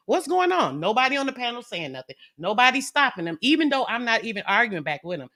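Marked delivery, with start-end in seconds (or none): 0.1-0.8 high-pitched mock disbelief; imitated bewilderment. Onlookers in the chat reacting to the rant, bewildered. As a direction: ask it high, as if baffled. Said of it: "they all tripping like".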